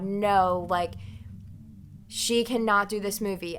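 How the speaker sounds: normal talking voice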